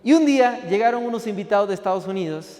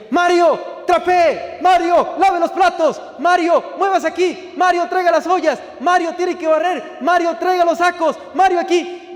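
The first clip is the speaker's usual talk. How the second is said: much higher, louder barked commands; shouted orders; 9 semitones higher, 5 dB louder, slower than usual. Retold or imitated. Kitchen bosses shouting chores at the new cook. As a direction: bark each order across the kitchen.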